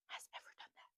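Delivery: hushed whisper, almost no voice; awed whisper. Real, not acted. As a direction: whisper it in disbelief. Awed telling of coffee moment.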